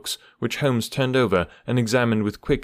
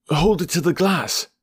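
raised, higher excited call; an eager, higher-pitched exclamation; excited cry; 9 semitones higher, 3 dB louder than usual. A narrator's reading. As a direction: urge him eagerly to hold it up. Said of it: "he cried".

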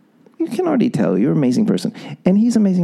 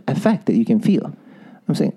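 ordinary delivery

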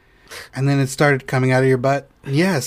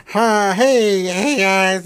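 mock chirpy greeting, higher; imitating her chirpy hello; 5 semitones higher, much slower than usual. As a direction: imitate her bubbly hey guys. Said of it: "That's how she talks".

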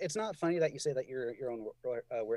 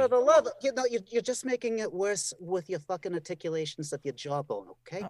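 loud, high exasperated scolding; mock scolding; 4 semitones higher, 3 dB louder than usual. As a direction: scold him with fake exasperation.

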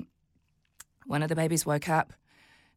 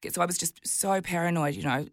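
ordinary talk, nothing marked

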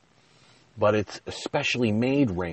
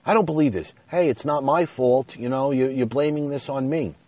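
high, raised-voice indignant protest; not nice back; 6 semitones higher, 4 dB louder than usual. Retold or imitated. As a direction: re-enact arguing back, aggrieved and defensive. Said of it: "I was certainly not nice back".

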